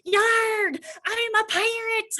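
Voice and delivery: yarg belted high and loud, slow pirate voice; loud pirate yell. Retold or imitated. Boy announcing to the executive across the room, loud pirate shout. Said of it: "I can hear him from, like, across the room".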